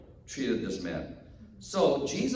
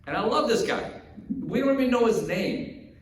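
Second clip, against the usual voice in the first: ordinary delivery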